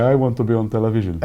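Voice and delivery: a hint of a thick Maltese accent; lightly put-on Maltese accent, self-mocking. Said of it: "with that thick Maltese accent".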